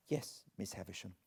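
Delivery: put-on soft, quick, timid boy's answer; meek reply. Pip answers meekly.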